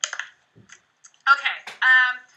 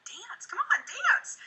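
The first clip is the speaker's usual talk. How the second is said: much higher, coaxing; urging; 11 semitones higher, 4 dB quieter, much slower than usual. Retold or imitated. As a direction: coax dad into dancing. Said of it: "I'm like".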